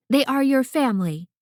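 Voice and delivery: snapped. A narrator's reading. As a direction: bite off each word firmly to correct the child. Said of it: "Verity snapped".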